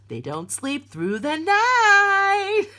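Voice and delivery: high, loud, slow teasing voice of the universe; the universe taunting a parent, high and slow; mocking universe. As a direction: drawl it out high and loud like a smug cosmic taunt.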